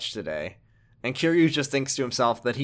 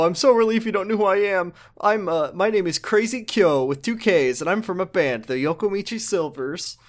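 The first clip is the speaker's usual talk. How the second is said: higher, relieved goofy voice; high greaser voice; 6 semitones higher, 3 dB louder than usual. Retold or imitated. Crazy Kyo introduces himself in high goofy voice. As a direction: introduce yourself like a giddy cartoon punk. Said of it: "so he says".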